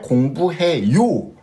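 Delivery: 공부해요 with its last syllable 요 punched; stress on final 요. Shown as a demonstration. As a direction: hit the ending 요 in 공부해요, letting it rise then fall. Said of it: "Termina en yu". (énfasis en la sílaba final 요 de 공부해요)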